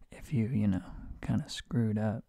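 ordinary delivery

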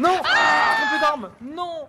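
high frustrated yell; frustrated shout. Real, not acted. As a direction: cry out in dismay. Dying in game.

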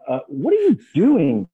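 drawn-out mock incredulity; imitated bafflement. Baffled trader questioning the newcomer, mock incredulous.

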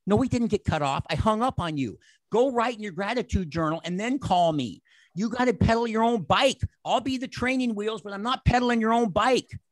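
scolding a friend who called back; mock stern scolding.

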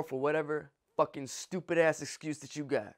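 normal talking voice

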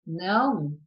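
usual speaking voice

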